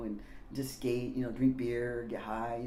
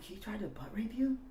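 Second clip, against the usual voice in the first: whispered, higher horrified question; shocked whisper; 8 semitones higher, 6 dB quieter than usual. Retold or imitated. Teen whispering to Randy in shock about the attack. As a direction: hush it like it's scandalous.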